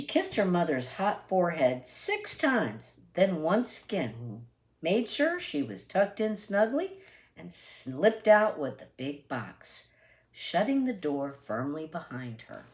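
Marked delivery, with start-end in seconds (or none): none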